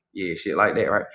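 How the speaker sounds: ordinary talk, nothing marked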